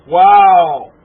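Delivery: normal talking voice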